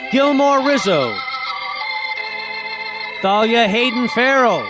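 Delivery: ordinary delivery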